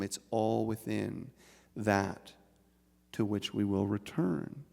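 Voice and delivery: ordinary talk, nothing marked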